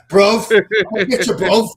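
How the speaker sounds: hammed-up Cockney bloke repeating bruv; playful Cockney impression, bruv over and over; mock Cockney. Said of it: "too much of a Cockney accent".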